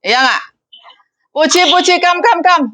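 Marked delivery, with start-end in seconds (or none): 1.3-2.7 high, sing-song summons; employer calling out. Retold or imitated. Madam summons her maid Puji, high calling voice. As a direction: call the helper over, pitched way up. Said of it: "gak mungkin kan diceluk".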